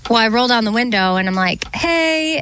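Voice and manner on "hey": calling out to driver. Retold or imitated. Calling to car behind, acted.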